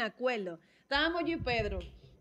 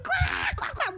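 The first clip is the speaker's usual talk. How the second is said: risa imitada; slower than usual. Retold or imitated.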